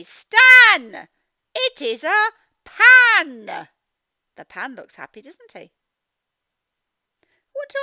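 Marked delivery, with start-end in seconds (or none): 0.0-3.7 Stan, it is a pan called out much louder and higher, slow; excited exclaim. Shown as a demonstration. Teacher showing children how an exclamation mark sounds, loud and excited. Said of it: "I need to say".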